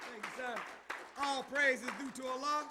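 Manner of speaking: ordinary talk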